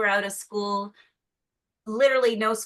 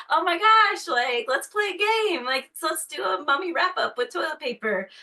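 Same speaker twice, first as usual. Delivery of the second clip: bright high forced cheer; chirpy overexcited mom; excited high voice; 8 semitones higher, 3 dB louder than usual. Retold or imitated. Parent voicing forced cheer.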